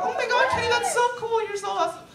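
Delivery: hammed-up fangirl voice praising Kenny; mock gushing fan. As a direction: gush over himself like an adoring fan.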